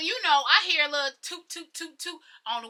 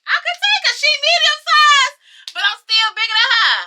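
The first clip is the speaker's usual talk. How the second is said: much higher and louder, hyping herself up to fight; hyped-up shout; 14 semitones higher, 11 dB louder than usual. Retold or imitated. Girl hyping herself up before a parking-lot fight, loud and high. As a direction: shout it like you're sure you can take her.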